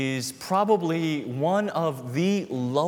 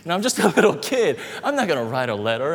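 fast, louder, high laugh through the words; laughing disbelief; 5 semitones higher, 8 dB louder, faster than usual. Real, not acted. Preacher laughing at the shopkeeper's advice, fast and loud. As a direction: laugh it off at speed. Kid mocking shopkeeper's suggestion.

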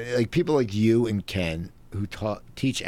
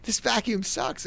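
put-on girlfriend's complaint; 10 semitones higher, 3 dB quieter, slower than usual. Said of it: "She goes".

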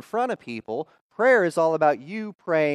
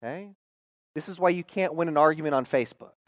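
usual speaking voice

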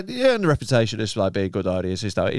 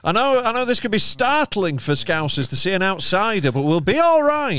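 reassuring the crowd; 3 semitones higher than usual. Acted. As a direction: soothe a suspicious crowd, pitched up. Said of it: "I'm like".

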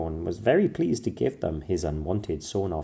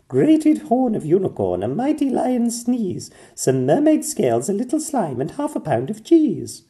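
high, louder princess voice; Pearl reading cure; 13 semitones higher, 7 dB louder than usual. A narrator's reading. Pearl reading the cure aloud, eager. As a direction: read the recipe as Pearl.

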